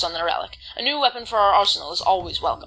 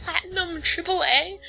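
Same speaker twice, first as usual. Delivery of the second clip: disbelieving question, pitched up; incredulous; 9 semitones higher than usual. Real, not acted. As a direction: repeat it in amazed disbelief. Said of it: "How does it get higher than that?".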